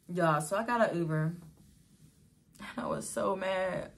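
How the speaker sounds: ordinary talk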